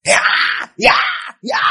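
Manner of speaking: short mock roars; preacher's roaring lion; lion roar imitation. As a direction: roar three times like a lion. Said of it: "like a roaring lion".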